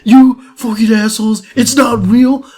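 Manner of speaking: high, loud, indignant rant; hammed-up shaken outrage, high-pitched; mock outrage. Pranked friend cursing out the pranksters, high mock outrage.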